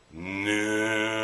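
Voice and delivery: long, drawn-out cow moo, gliding upward. Acted. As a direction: answer as a mooing cow. Voicing cows asked if they like it.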